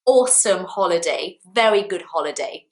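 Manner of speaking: ordinary delivery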